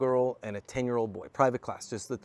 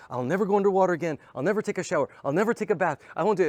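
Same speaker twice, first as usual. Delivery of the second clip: put-on high, loud, panicky child's wail; mock a scared kid refusing ever to go underwater; imitating crying boy; 6 semitones higher, 5 dB louder, faster than usual. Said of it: "And he starts crying. He goes".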